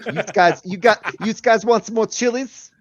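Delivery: high, put-on Pennsylvania Dutch accent. Mocking a Pennsylvania housekeeper with a hammed-up Pennsylvania Dutch accent. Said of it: "Pennsylvania Dutch Utes".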